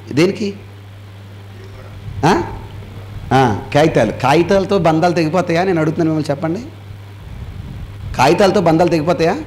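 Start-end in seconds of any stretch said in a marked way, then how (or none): none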